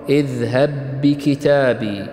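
اذهب بكتابي slowly joined on one doubled ب; fuse the end of اذهب into the start of بكتابي, drawn out; merged ب. Shown as a demonstration. Teacher giving a second idgham example, merged ب. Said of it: "الإدغام، الإدغام الصغير".

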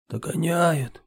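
high-pitched wheeze of alarm; hoarse alarm. A narrator's reading. Rower warns the chief, hoarse alarmed cry. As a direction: gasp out the warning. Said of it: "прохрипел Гуннар".

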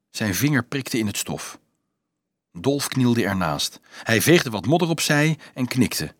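ordinary delivery, nothing marked

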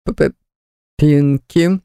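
choked hoarse stammer; hoarse croak. A narrator's reading. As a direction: croak the name out in shock. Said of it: "прохрипел магистр Лавадус".

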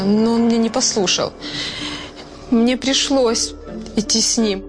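pleading. Acted. Suspect pleading her innocence.

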